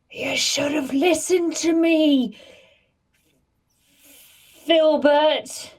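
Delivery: high, drawn-out reproach; in-character scolding. Acted. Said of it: "point at him and say".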